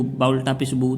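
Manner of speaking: quick, with a short "बू"; unstretched reading. Shown as a demonstration. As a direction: say "बू" briefly, without holding it. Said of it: "तो ये गलत हो जाएगा". ("बू" बिना खींचे, जल्दी में)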